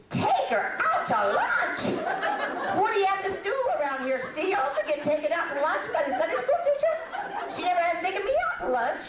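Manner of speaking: high, laughing mock outrage; mock indignation. Jealous student squealing at the teacher's lunch offer, high laughing outrage.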